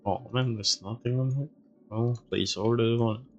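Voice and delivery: usual speaking voice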